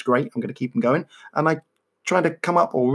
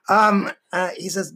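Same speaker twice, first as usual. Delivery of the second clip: ordinary talk, nothing marked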